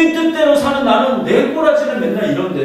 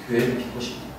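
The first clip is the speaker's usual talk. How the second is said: whispered repeat; 8 semitones lower, 12 dB quieter, much faster than usual. Shown as a demonstration. Repeats line, second time whispered.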